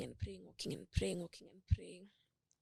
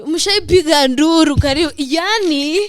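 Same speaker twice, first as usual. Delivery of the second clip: near wail; 6 semitones higher, 16 dB louder than usual. Retold or imitated. Near-wailing in grief retold.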